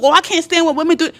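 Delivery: quick, high-pitched mimicked complaint; mock yelling rant. Man ranting to throw her off, mock yelling. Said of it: "all the yelling and screaming".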